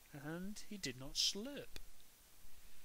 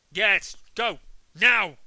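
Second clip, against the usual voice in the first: much louder stern order; dad ordering Peter to his room; angry dad shout; 6 semitones higher, 19 dB louder, slower than usual. A narrator's reading. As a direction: bark the command.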